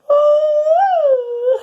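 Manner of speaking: very high, loud long waking ooh. Acted. Mole waking up with a long high ooh. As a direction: stretch a high wake-up ooh. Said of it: "went Mole".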